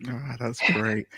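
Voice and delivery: ordinary talk, nothing marked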